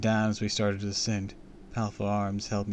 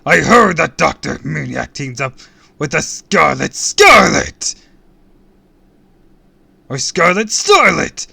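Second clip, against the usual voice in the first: loud, high villain bellow; raging shout; 7 semitones higher, 16 dB louder than usual. A narrator's reading. Villain ranting about his enemy's partner, bellowing. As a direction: bellow it, dragging out the name.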